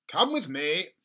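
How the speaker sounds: loud, eager call; father calling George to bring the medicine; yelling. Acted. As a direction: call George along urgently. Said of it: "Mister Crankie yelled".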